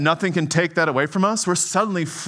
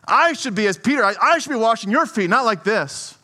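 protesting Peter; 6 semitones higher than usual. Retold or imitated. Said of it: "He looks at that and says".

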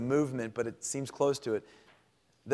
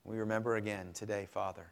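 prayer; 3 semitones lower, 4 dB quieter than usual. Acted. Pastor leading the congregation in quiet prayer. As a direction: pray quietly and humbly before God.

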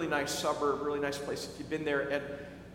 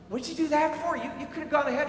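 baffled passenger; 8 semitones higher, faster than usual. Retold or imitated.

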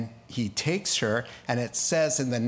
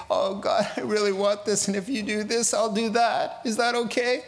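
wheedling bargainer; 6 semitones higher than usual. Retold or imitated. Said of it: "we don't need to say".

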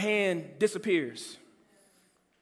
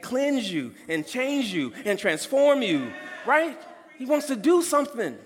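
preaching shout; 4 semitones higher than usual. Real, not acted. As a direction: drive it home at full voice. Preaching climax.

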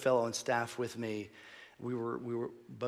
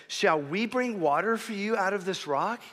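raised-pitch, indignant retold question; fired-up anger; 7 semitones higher, 4 dB louder than usual. Preacher quoting Moses confronting the people, fired-up anger. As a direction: throw it at them as an angry challenge. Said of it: "fired up and he was angry".

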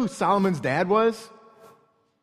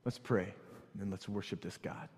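much softer, reflective preaching; soft closing; 11 semitones lower, 12 dB quieter than usual. Acted. Pastor closing sermon before prayer, soft reflective. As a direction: wind down to a hush.

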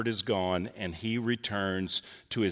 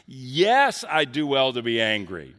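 defiant angry retort; 2 semitones higher, 6 dB louder than usual. Retold or imitated. Jonah answering God's question, loud defiant retort. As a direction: throw it back at God in self-justified anger. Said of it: "He says".

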